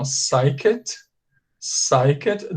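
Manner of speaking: PSYCHED ending in a clear T; final T sound demonstration. Shown as a demonstration. Teacher shows the ending sound, carefully. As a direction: say "psyched" as "sy-ket", crisp final t. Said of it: "Aqui tem som de, de T".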